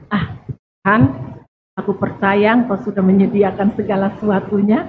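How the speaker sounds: calm trusting prayer. Retold or imitated. Mimics calm trusting believer.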